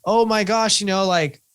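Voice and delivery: high, bright-eyed mock discovery, pitched well up; mock realization. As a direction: act out suddenly realizing it about yourself. Listing mock self-discoveries.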